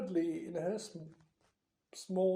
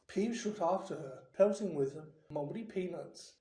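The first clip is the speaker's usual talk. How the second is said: plain reading voice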